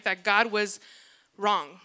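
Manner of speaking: ordinary talk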